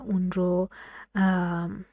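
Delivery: usual speaking voice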